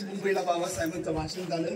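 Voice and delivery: ordinary talk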